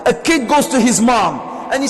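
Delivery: preaching. Acted. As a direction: press the point home to the congregation. Preacher raises voice on mothers' sacrifice.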